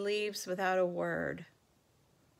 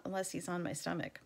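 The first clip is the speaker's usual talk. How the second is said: ordinary delivery, nothing marked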